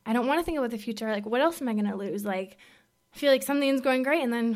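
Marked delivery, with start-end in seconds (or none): 0.1-2.2 fast, anxious tumble of words; rushed fearful quote. Retold or imitated. Quoting her past fearful self to the audience, rushed. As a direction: blurt it out before you can stop yourself. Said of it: "I was just like".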